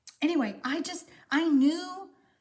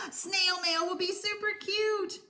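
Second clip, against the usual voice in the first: gasping delight; 6 semitones higher than usual. Real, not acted. Reading a viewer comment delightedly.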